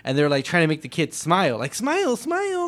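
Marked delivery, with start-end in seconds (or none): 1.8-2.7 high sing-song coaxing; coaxing mom voice. Retold or imitated. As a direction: coax a toddler to smile. Coaxing a kid for a photo.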